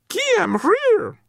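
very high piping voice; small furry creature. A narrator's reading. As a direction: pipe it out in a tiny creature voice.

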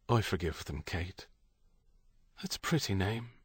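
soft, tender concession. A narrator's reading. Stephen forgiving for Kate and their daughter, tender. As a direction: give in gently and quietly. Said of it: "He felt a wash of tenderness".

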